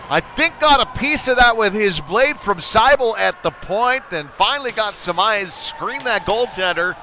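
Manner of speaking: huge high shout on the score, staying high and loud after; goal call. Real, not acted. Announcer calling the tying goal, shouting high and loud. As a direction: scream the equalizer.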